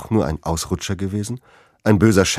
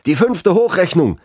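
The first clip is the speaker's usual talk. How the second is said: high, louder call across the room; 10 semitones higher, 3 dB louder than usual. A narrator's reading. Someone in the hall calls out the next projection, raised call. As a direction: shout the news over the crowd. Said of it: "rief jemand".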